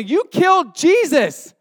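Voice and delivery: loud, high and slow accusation; accusing shout. Acted. Stephen staring down the listeners, a loud slow accusation. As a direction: jab the words at them, loud and slow.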